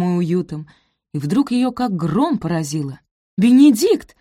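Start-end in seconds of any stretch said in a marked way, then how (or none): 3.4-4.2 high, loud startled cry; alarmed gasp. A narrator's reading. Sophie wakes and realises she is late, alarmed cry. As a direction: cry out the name in sudden alarm. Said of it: "ахнула она".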